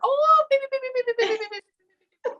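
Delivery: mock shrill beeping voice; high-pitched annoying voice. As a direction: squeal the beeps as high as you can. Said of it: "high pitched, annoying".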